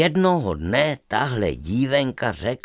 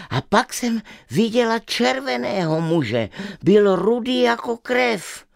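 put-on lightly raised, eager little girl; a curious girl pleading to visit; girl's voice; 10 semitones higher than usual. Stubborn girl tells her parents she is curious, lightly girlish.

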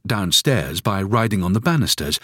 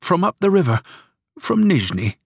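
higher, lightly voiced old woman; grandmother's answer; 11 semitones higher than usual. A narrator's reading. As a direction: give grandmother a gentle lift. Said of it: "she answered".

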